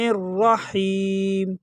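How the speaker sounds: الرحیم very slowly, as "nir-raheem"; joined nun and ra. Shown as a demonstration. Teacher showing how letters join, slow "nir-raheem". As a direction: run n straight into a held r in الرحیم, long final vowel. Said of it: "نون کو را کے ساتھ direct ملائیں گے".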